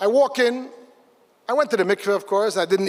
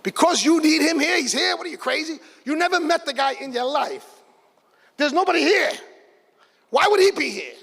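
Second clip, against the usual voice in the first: incredulous self-talk; 7 semitones higher than usual. Retold or imitated. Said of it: "But then I said to myself".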